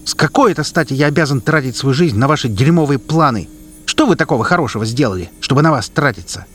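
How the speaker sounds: loud, furious outburst; angry shout. Acted. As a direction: shout back in anger. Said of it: "кричит юноша". (кричит со злостью)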